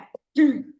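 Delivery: a loud rough throat clear; sharp throat-clearing. Real, not acted. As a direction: clear the hoarse throat hard. Hoarse teacher clears throat.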